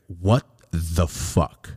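low, very slow imitation of shock; retold stunned reaction, one word at a time; stunned disbelief. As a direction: draw each word out in mock disbelief. Overheard stunned reaction to divorce papers.

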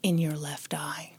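soft unvoiced whisper. Host repeating Byron's words to his recorder, whispered. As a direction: hush it, barely any voice. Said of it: "Byron whispered to his little recorder".

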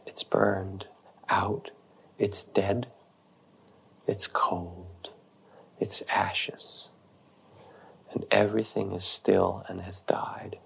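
hushed, drained whisper; whispering, hollow. Retold or imitated. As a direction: voice the ashes, barely a breath. Woman describes dead, burnt-out feeling.

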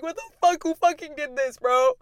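high outraged disbelief; shocked disbelief. Retold or imitated. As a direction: pitch climbing in disbelief. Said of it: "And I was like".

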